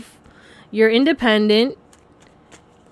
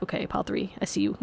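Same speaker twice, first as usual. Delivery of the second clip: much softer, casual nod of approval; offhand reply; 3 semitones lower, 14 dB quieter than usual. Retold or imitated. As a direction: toss it off quietly like a quick acknowledgment. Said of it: "'cause it's like".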